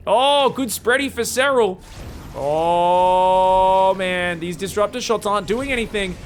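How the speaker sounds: rising, loud hype over the fight; excited casting. Real, not acted. Caster to viewers as Serral wins the fight, loud and excited. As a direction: call the fight with building excitement.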